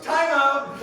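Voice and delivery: very loud, high-pitched dad's objection; alarmed shout. Retold or imitated. Imitating dad halting a proposal at dinner, alarmed shout. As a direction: cut in with a big comic yell.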